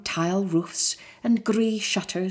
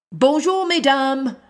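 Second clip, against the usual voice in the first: cheerful greeting; 6 semitones higher, 6 dB louder than usual. Acted. Lion greets ladies.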